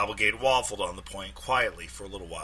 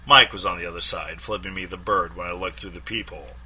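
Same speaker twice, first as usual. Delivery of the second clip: ordinary delivery, nothing marked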